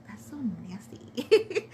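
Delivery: whispered, drawn-out disgust; disgusted aside. Retold or imitated. Wife watching husband eat on hold, whispered disgust. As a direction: whisper it slowly, grossed out.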